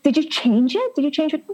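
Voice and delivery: high, incredulous imitation of a prying question; mock nosy stranger. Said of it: "I'll be like".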